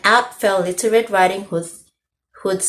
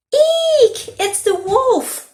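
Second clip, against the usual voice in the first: high frightened shriek, slow on the words; 4 dB louder, much slower than usual. A narrator's reading. Girl shrieking on finding the wolf in bed.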